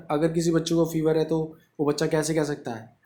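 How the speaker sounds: ordinary talk, nothing marked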